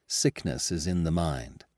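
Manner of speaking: usual speaking voice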